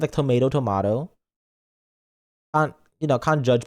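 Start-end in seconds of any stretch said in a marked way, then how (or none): 0.1-1.1 "tomato" as to-MAY-to, then to-MAH-to; two pronunciations. Shown as a demonstration. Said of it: "It's the same thing with".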